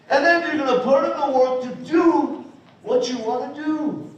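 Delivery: ordinary talk, nothing marked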